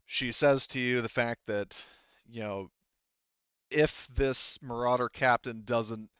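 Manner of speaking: normal talking voice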